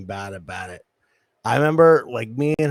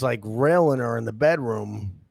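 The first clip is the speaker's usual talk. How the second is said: ordinary talk, nothing marked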